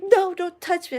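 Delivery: high-pitched mimicked protest; mock upset, high voice; retold upset protest. Retold refusal after losing a fight.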